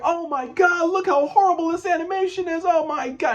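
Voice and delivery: very high, squealing mockery; mocking voice. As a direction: shriek like a teasing kid. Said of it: "He's like".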